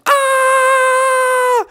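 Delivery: long, high scream; acted terrified scream; sustained high "ah" of panic; group scream. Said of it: "everybody else was going".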